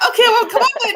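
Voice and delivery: high laughing mock of a friend; imitating Mary. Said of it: "She'll be like".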